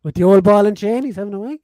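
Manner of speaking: loud, high disbelieving check; shocked he got it so wrong, asking loud; incredulous question. Real, not acted. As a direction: ask it in total disbelief. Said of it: "Oh, yeah, okay".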